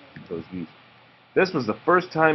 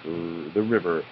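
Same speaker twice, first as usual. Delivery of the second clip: ordinary delivery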